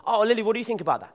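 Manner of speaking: fast, high, bright mock asking; mock chirpy question. Mimicking asking quiet Lily.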